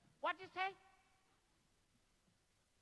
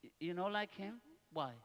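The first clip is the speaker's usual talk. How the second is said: ordinary talk, nothing marked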